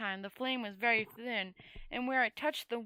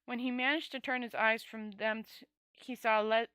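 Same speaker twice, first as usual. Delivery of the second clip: plain reading voice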